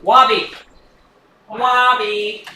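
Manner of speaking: "Wobby" with a w sound for the R; says w for r. Shown as a demonstration. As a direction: say "Wobby", R turned into w. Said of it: "I just can't say my R's".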